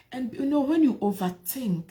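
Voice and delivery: ordinary delivery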